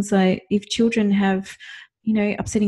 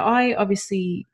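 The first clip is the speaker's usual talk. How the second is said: usual speaking voice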